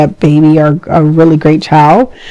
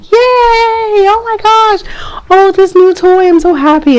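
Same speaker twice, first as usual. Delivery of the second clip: put-on high squeaky delighted baby, slow on oh my gosh; 13 semitones higher, slower than usual. Vlogger voicing a baby thrilled with a new toy, high squeaky delight.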